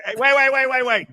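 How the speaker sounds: high, loud rush of waits; real excitement, cutting in high and loud; excited interrupting. Real, not acted. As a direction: jump in eagerly to stop him. Excited interruption about movie.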